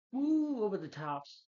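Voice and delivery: high, loud mock whoop; hammed-up whoop, dropping back down; over the top. Reviewer mimicking Jimmy as the devil, over-the-top whoop. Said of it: "Jimmy's very over the top".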